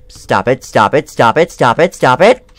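frantic repeated plea; panicked pleading. Real, not acted. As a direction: beg it to stop, rising panic. Pleading during scare.

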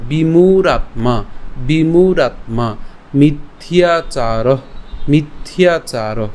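each word drawn out slowly; slow word drill. Shown as a demonstration. Teacher drills single words for the class. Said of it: "এরপর দ্রিয়ার্থান".

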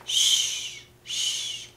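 very soft, drawn-out "shh"; hushing. Acted. Grandma hushes for sleeping bunnies, soft shushing. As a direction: hush gently, twice.

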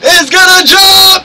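high, rising-stakes wrestling shout; excited match call. Real, not acted. Commentator warning viewers of a jump, excited high call. Calling a move in the game.

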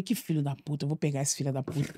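quiet scheming mutter. Retold or imitated. Plotting revenge on the boy.

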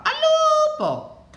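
mischievous shout for help; cry of wolf. A narrator's reading. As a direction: call out the false alarm, stretching the vowel. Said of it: "Qualche volta gridava".

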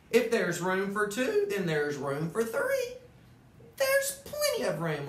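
high, bright chirping bird voice with a laugh; chirpy bird. Acted. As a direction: chirp it like a cheeky little bird. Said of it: "and he chirped".

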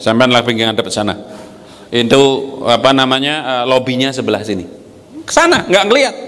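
ordinary delivery

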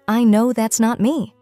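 usual speaking voice

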